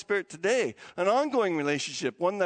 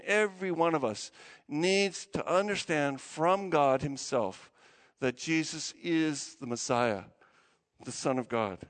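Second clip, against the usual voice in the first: ordinary talk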